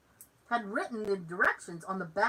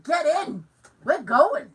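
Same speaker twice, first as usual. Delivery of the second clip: louder, hurried get-away call; urgent call; 5 semitones higher, 8 dB louder, slower than usual. Retold or imitated. Telling dad to jump in the car, urgent loud call. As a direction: hustle dad into the car loudly. Fleeing the storage unit with dad.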